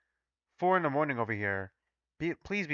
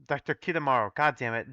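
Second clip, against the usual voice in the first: usual speaking voice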